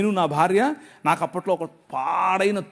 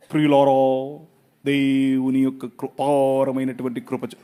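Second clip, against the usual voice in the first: slow, lower, pompous prayer voice; mock flowery prayer; 7 semitones lower, slower than usual. Preacher mocking fancy prayer language, pompous slow prayer. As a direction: parody an overly ornate prayer. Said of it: "flowery language మాట్లాడక్కర్లేదు".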